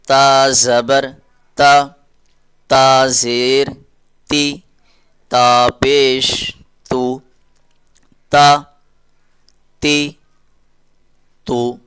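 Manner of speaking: slow, drawn-out letter drill; slow teaching drill. Shown as a demonstration. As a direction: spell each sound out slowly for children. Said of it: "teacher drilling letter with vowel marks". (آہستہ تدریسی مشق)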